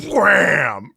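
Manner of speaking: loud, high comic-book wham; shouted wham. Retold or imitated. Narrating the blast hitting Wolverine, shouted wham. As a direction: shout it like a comic sound effect.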